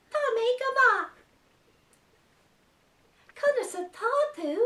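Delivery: a tiny excited puppet shouting hello; puppet character voice. Acted. Puppet greeting the children in Irish, high and squeaky. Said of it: "two new characters".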